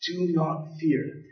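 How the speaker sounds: quiet and slow, each word set apart; hushed, slowed-down reassurance; soft, slow command. Shown as a demonstration. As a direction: ease it out gently, like God soothing. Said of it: "God tells us".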